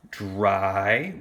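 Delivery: "Dry" held out on its vowel; long I syllable. Shown as a demonstration. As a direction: stretch "Dry" as a separate syllable. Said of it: "our first syllable".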